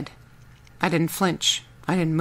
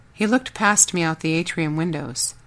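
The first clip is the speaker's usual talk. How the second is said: ordinary delivery, nothing marked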